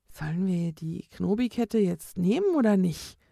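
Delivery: ordinary delivery, nothing marked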